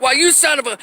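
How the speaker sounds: high-pitched imitated indignation; indignant outburst, put on; mock outrage. Imitates outraged people confronting Peter.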